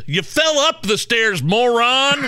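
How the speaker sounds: high, loud put-on voice for the wife, ending on a scornful "moron"; high-voiced wife calling him a moron; mocking wife. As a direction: imitate the wife scolding him, high and exasperated. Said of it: "She goes".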